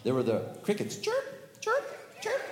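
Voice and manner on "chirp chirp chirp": very high squeaky chirps; cricket chirps. Acted. Storyteller voicing crickets, very high chirps.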